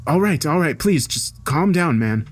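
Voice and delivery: alarmed, pitched well up; frightened. A narrator's reading. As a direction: beg him to calm down. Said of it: "beginning to really frighten him".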